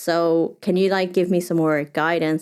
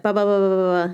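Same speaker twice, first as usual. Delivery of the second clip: mock hyper editing; 2 semitones higher, faster than usual. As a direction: spit out nonstop filler with no pauses. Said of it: "super hyper stimulating".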